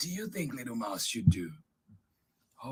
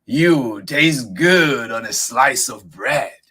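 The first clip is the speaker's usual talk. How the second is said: big, slow monster voice; loud, drawn-out storybook monster; hungry Gruffalo; 5 semitones higher, 13 dB louder, slower than usual. Acted. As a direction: boom it slowly at your prey. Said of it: "the Gruffalo said".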